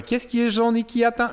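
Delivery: put-on high, sing-song kid's riddle voice; meme quote voice. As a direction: pitch way up like the viral kid. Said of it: "L'as-tu vu, lui?".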